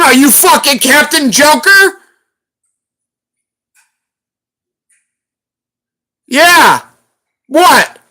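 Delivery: high, loud mocking tirade; angry berating. Real, not acted. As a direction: tear into the moderator. Berating a moderator on live.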